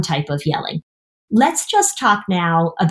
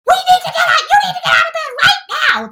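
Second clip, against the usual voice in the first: mock yell; faster than usual. Parent finally yelling at kid in bed, mock shout. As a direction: lose it and holler at the kid. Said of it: "And then when you come in and you're like".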